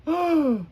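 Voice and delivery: sharp high startled gasp. Acted. Reading to kids, Grover gasps as the page turns. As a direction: gasp in fright, high and loud.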